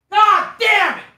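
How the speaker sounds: real frustrated yell; angry outburst. Real, not acted. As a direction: explode at the lost nap. Outburst over losing his nap.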